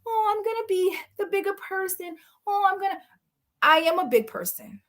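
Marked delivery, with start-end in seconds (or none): 0.1-3.0 high mocking voice; mock them sweetly; mocking cast. Mocking castmates being the bigger person, high and sweet. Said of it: "everybody was like".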